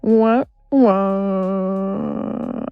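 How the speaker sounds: loud mock wah-wah; mock sad trombone sound. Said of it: "And it's pretty sad".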